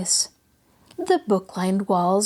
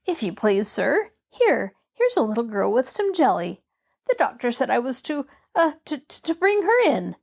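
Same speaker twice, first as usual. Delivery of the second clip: timid maid, higher and hesitant at the end; frightened; 3 semitones higher than usual. Acted. Maid announcing a visitor to her cross master, frightened. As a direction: stammer nervously through the announcement. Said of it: "saying in a frightened voice".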